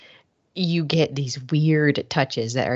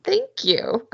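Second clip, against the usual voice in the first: high-pitched, uncomfortable mock thanks; awkward, squeaked-up "oh, thank you"; 5 dB louder, slower than usual. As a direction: imitate someone awkwardly accepting thanks, pitched way up. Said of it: "So awkward".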